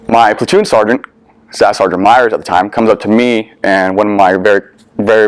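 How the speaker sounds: usual speaking voice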